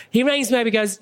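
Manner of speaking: ordinary talk, nothing marked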